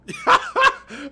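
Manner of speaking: sudden loud high-pitched laugh; high burst of laughter. Real, not acted. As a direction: laugh in disbelief at the record. Laughs at final record.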